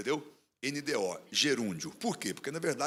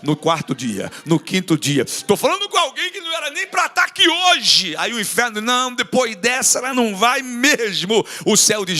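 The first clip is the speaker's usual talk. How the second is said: loud, shouted preaching that builds; fiery revival preaching; full-force preaching; 4 semitones higher, 10 dB louder than usual. Acted. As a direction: preach it to the rafters.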